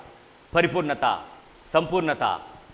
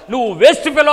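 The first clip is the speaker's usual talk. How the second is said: louder, higher quoted put-down; acted rebuke; 4 semitones higher, 7 dB louder than usual. Preacher voicing the Lord's verdict on someone, loud rebuke. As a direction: pronounce a damning verdict loudly.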